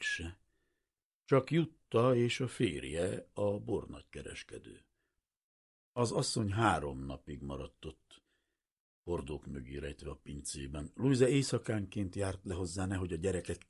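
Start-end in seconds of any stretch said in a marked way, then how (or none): none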